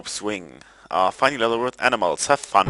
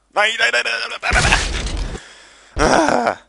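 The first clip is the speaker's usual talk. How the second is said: very high rapid panicked repeats; panicked no's; 13 semitones higher than usual. Real, not acted. Gamer watching his character fall, panicked high no's. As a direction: protest over and over as it goes wrong.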